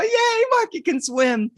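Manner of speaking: high, slow sing-song cheer; kids cheering. Retold or imitated. Cousins cheering as Marky surfaces, high cheer. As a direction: cheer it like relieved little kids.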